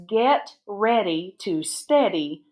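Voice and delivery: slow, word groups set apart by pauses; slow dictation. Shown as a demonstration. Teacher dictating a sentence to pupils, slow dictation. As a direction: dictate it slowly for writing down. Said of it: "the sentence I want to start with".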